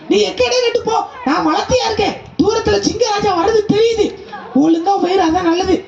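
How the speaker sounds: high animal voice, urgent warning; high giraffe warning. Acted. Giraffe warns the goat the lion is coming, high voice.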